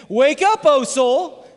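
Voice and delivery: loud, high, drawn-out rallying cry; rousing call. Shown as a demonstration. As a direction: lead the congregation in a shouted refrain. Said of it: "say it with me again".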